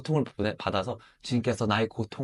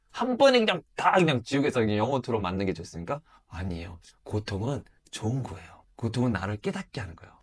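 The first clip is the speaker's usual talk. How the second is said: ordinary talk, nothing marked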